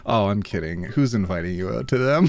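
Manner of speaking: ordinary talk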